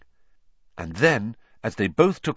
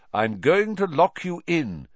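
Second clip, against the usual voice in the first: lightly voiced calm old wizard; measured, kindly elder; a hint of a wise old man; old headmaster; 7 semitones higher than usual. A narrator's reading. Voices Dumbledore.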